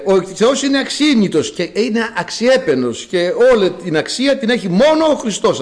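ordinary talk, nothing marked

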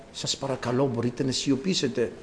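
soft, low, courteous murmur; mock polite request. Preacher parodies a courteous request for silence, soft and polite. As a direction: ask very gently, voice well down. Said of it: "δεν του μιλάγανε ευγενικά".

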